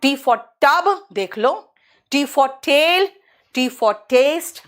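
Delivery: tub, tail, taste with a crisp retroflex T; teacher listing T words slowly; hard t demo. Shown as a demonstration. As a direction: tap each T sharply. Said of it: "ट निकलता है बस".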